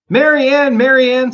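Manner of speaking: high, loud call across the house. Acted. Rabbit calling loudly for his maid Mary Ann. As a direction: shout for the maid upstairs.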